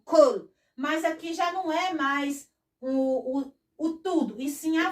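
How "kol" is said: "kol" said louder with a crisp k and an open o; Hebrew kol. Shown as a demonstration. Teacher showing that the word for voice sounds the same. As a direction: say "kol" clearly as a demonstration. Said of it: "o mesmo som".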